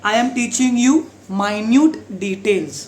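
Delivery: very slow, minute said my-nyoot; slow example sentence. Shown as a demonstration. Teacher slowly modelling minute in a sentence. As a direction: drag it out word by word. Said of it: "minute मतलब छोटा छोटा".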